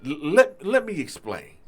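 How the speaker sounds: usual speaking voice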